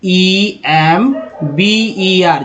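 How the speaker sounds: each letter drawn out, very slowly; very slow letter-by-letter spelling. Shown as a demonstration. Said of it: "एक word ले लेते हैं remember का".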